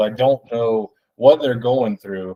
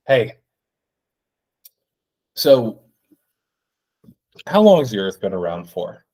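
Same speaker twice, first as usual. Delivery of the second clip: podcaster impression. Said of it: "terrible impersonation of it".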